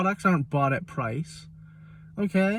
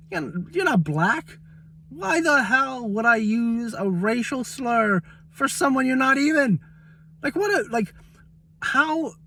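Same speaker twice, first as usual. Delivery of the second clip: higher-pitched, exasperated disbelief; incredulous indignation; 6 semitones higher than usual. Retold or imitated. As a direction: retell your outraged comeback, pitch climbing as you go. Acted indignant retort to rude seller.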